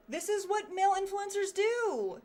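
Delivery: loud, high, stressing male; hammed-up indignant announcement; mock outrage. Streamer mocking male influencers, loud and high.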